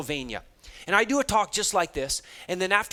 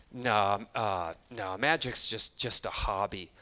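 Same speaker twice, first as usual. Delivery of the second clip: lower, softer mumbled no from a shrunken student; shy student; 9 semitones lower, 6 dB quieter, slower than usual. Retold or imitated. Student telling the speaker magic is only a hobby, shy and quiet. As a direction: shrink away as you answer.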